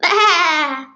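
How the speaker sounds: long, loud baa; loud sheep bleat. A narrator's reading. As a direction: bleat out loudly from below. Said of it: "there was a loud".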